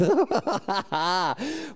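delighted laugh. Real, not acted. Preacher laughing at his own story, delighted laugh. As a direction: laugh at the punchline.

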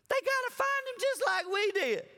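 high laughing delivery. Real, not acted. Preacher to congregation, laughing.

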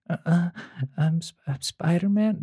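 lightly voiced awkward Spider-Man; dorky Peter Parker. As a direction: imitate a flustered dork introducing himself. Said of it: "He'll be in three modes".

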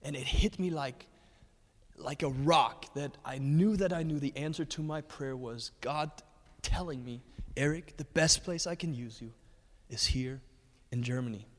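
usual speaking voice

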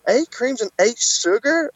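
high, slow cashier's query; drawn-out, high-pitched disbelief; mock incredulous. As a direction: imitate a cashier repeating an absurd order. Cashier incredulously repeats his order.

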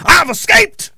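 "I've escaped" sung out high with a rising, hammed-up crow; mock gloating taunt. Ranter taunting trapped viewers, high mock gloating crow.

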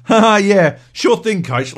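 loud, biting fake laugh into the reply; mock-grateful, forceful sarcasm; sarcastic agreement. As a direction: pretend to agree while resenting the advice. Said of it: "it sort of twists. And then suddenly".

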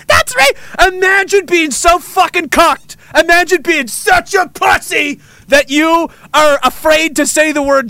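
high, shouted mocking rant; scornful rant. Host berating the timid over saying Chinese, scornful shouted rant. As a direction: rail at them at the top of your pitch. Mock rant at people afraid to speak.

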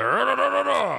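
high, rhythmic roaring babble; mock movie-star roar; Al Pacino. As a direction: imitate a loud ranting actor in nonsense syllables. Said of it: "old Pacino movies and you're used to".

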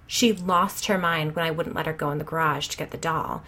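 ordinary delivery

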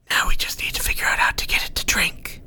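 breathy unvoiced whisper, close and secret. Unseen Nordstrom whispering advice to Ank. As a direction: murmur it like a hidden advisor in his head.

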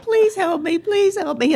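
high desperate please help me; panicked plea. Retold or imitated. Trapped passenger begging nearby strangers for help. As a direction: plead with everyone around you. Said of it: "I'm looking around like".

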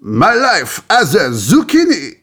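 put-on high French-accented game show host. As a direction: announce it with a hammed-up French accent. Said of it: "in a game show host".

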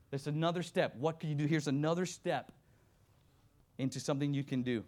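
ordinary talk, nothing marked

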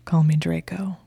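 husky whisper, rising at the end; huskily. Acted. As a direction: breathe it huskily into her ear. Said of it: "he said huskily".

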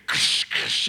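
unvoiced crunching helmet sounds; helmet crash noise. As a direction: imitate helmets smashing on TV. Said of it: "all you hear on T V is".

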